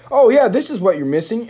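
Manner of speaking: high, breezy know-it-all voice; helpful expert. Retold or imitated. Imagining an expert fixing his mic setup, high breezy voice. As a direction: play the casual expert who spots the fix. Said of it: "someone that knows what the fuck".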